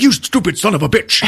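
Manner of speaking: high-pitched mock cursing; angry character voice. Magua curses man who tripped him.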